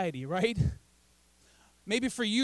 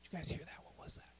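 hushed whisper; 5 semitones lower, 17 dB quieter, faster than usual. Pretend. Preacher asks the crowd if they heard that, hushed whisper. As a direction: drop to a very soft whisper. Acting out hearing a distant sound.

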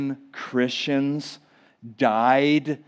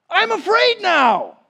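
higher, louder frightened cry; mock fearful disciple; 6 dB louder, slower than usual. Disciples fearing being sent among wolves, mock fright. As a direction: play the scared disciple. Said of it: "the disciples are thinking what you're thinking".

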